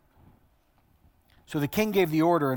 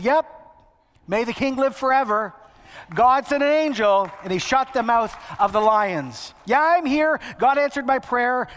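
higher-pitched, chipper acted reply; upbeat calling back; 5 semitones higher, 3 dB louder than usual. Daniel answering the king from the den, upbeat and high. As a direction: call back brightly, voice lifted.

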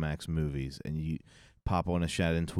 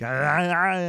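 much higher, louder, guttural mock German sounds; 11 semitones higher, 7 dB louder than usual. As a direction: bark out a silly fake German. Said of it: "I thought German was more like".

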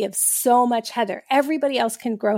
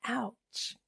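much softer, dry "Ouch"; deadpan ouch; 16 dB quieter than usual. Retold or imitated. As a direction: mutter it, wounded. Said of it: "I was like".

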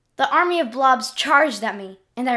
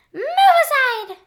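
very high, slow, loud squeaky command; high-pitched King Glob; 14 semitones higher, 6 dB louder, slower than usual. Acted. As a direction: bark it as the tiny king. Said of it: "The high-pitched voice of King Glob".

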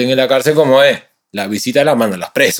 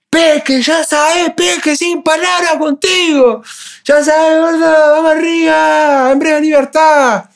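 calling out; slower than usual. Retold or imitated. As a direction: call back from inside the cell. Prisoner calls back from cell.

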